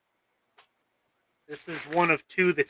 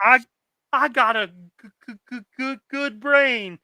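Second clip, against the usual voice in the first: stuttering simpleton; 7 semitones higher than usual. Retold or imitated. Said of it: "Simple Jack quote".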